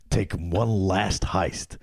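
slow, drawn-out trailer narration; narrating voice. Pretend. Co-host pitching the heist film, narrating voice. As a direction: spell out the heist premise with mock drama. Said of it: "my narrating voice".

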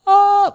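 very high, loud cry; high loud cry up an octave. Retold or imitated. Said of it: "I'm getting up into this high octave".

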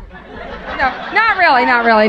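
wife laughingly teasing her husband; laughing denial. Retold or imitated. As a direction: tease him through laughter. Teasing husband about holding hands.

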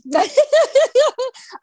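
high loud burst of real laughter; sudden delighted laugh. Real, not acted. Host laughs at the story.

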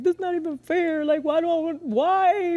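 high whiny protest; whiny young self. Retold or imitated. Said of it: "I was like".

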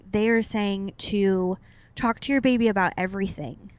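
ordinary talk, nothing marked

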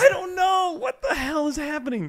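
high, exasperated shout of disbelief; baffled outburst. Real, not acted. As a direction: cry out in real bafflement. Baffled rant about Twitch trends.

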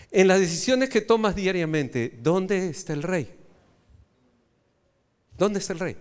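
normal talking voice

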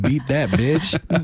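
hammed-up taunting boast; mock tough talk. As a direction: slowly taunt like a brash rival. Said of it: "you'd be like".

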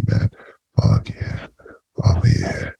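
usual speaking voice

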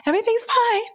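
very high, falsely breezy; faked cheeriness. Broke woman hiding her troubles from bestie, high fake-cheerful. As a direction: chirp it high to cover the trouble. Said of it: "I was gonna fake the funk".

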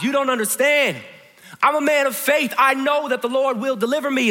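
emphatic cry up to the rescuers. Acted. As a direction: shout your faith over the rotor noise. Stubborn man shouts at helicopter.

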